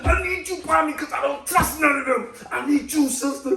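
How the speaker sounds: high, drawn-out pleading yell; retold yelling. Patient yells to the nurse as the door shuts, pleading. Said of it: "I started yelling at the sister".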